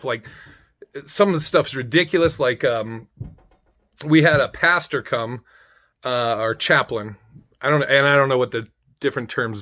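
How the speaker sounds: usual speaking voice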